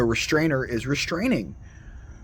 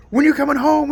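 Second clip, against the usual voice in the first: put-on much higher, louder eager child's plea; child calling dad; 12 semitones higher, 7 dB louder, faster than usual. Child on the phone to dad, high eager call. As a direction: call out like a kid impatient for dad to come home. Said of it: "we're calling dad on the telephone".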